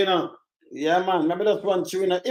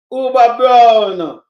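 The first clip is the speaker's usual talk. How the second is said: slow, stretched, much louder hail; long loud call; 8 dB louder, much slower than usual. Real, not acted. Streamer greeting a viewer in chat, slow loud drawn-out call. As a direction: drag the name out like calling across a yard.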